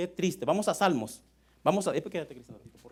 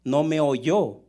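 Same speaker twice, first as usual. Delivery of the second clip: loud, deliberate scripture reading; lectura bíblica solemne; 2 semitones lower, 8 dB louder than usual. Acted. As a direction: proclaim the psalm to the congregation, loudly. (lectura del salmo, fuerte y pausada)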